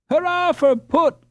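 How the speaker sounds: very high, slow triumphant cheer. A narrator's reading. Boys cheering as Jacob comes, excited cheer. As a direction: cheer with all your heart. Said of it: "cried all the boys".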